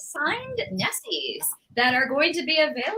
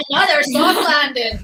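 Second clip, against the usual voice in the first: character catchphrase; 3 semitones higher, 8 dB louder than usual. Shown as a demonstration. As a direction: deliver the line like the character. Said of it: "And once again".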